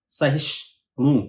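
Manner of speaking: सहिष्णु drawn out very slowly. Shown as a demonstration. Teacher isolating the hard cluster, very slow. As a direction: sound out सहिष्णु syllable by syllable. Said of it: "सहिष्ण के बाद तोड़ना है".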